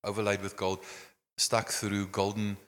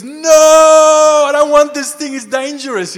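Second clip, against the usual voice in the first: much higher, much louder frantic shout, ending in a laugh; panicked refusal; 17 dB louder, slower than usual. Retold or imitated. Obed Edom refusing the ark.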